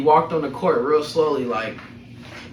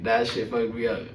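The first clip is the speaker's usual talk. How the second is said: ordinary talk, nothing marked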